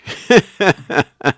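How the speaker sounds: bursts into a high laugh. Real, not acted. Host cracking up at the wrench joke, high laugh.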